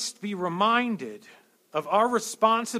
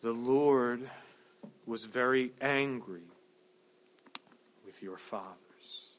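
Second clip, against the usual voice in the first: solemn reading; 7 semitones lower, 6 dB quieter, slower than usual. Shown as a demonstration. Reads scripture slowly.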